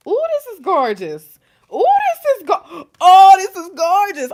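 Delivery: loud, high-pitched oohing; delighted gushing. Real, not acted. Reader thrilled by the cards under there, gushing loudly. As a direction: gush with rising excitement and delight.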